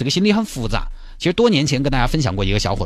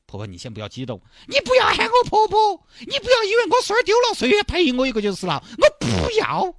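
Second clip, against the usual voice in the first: granny lamenting her lost grandson to the teacher, high and wailing; wailing grandma. Acted. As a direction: wail and threaten like a distraught old granny. Grandma wails over lost grandson.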